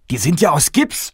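king complaining that the tarts are plaster; surprised exclamation. Acted. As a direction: blurt it out, baffled.

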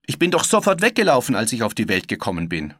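put-on loud, fast boy's voice, starting high; young boy blurting out his explanation; brash boy Peter Pan. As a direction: rattle it off defiantly, high then dropping.

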